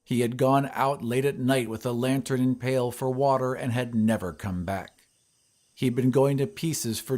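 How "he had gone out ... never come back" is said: usual speaking voice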